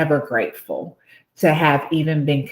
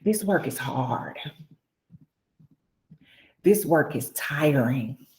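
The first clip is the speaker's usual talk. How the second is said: soft, halting, holding back tears; close to tears; 6 dB quieter than usual. Real, not acted. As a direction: speak softly through welling emotion. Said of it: "you're gonna make me cry here".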